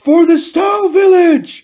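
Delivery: high, loud battle cry; excited scream. Acted. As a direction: shout it out as a thrilled rallying cry. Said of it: "He then screams in excitement".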